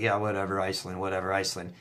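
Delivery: low, soft, bored brush-off; dismissive. Retold or imitated. Ex boyfriend waving off her Iceland dream, soft and bored. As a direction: shrug it off as if it bores you.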